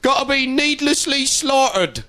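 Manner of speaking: high, doting farmer voice pleading for his cows; mock weepy farmer. Farmer on TV pleading for his cattle, high and doting. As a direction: gush like a farmer mourning his herd. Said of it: "in fields going".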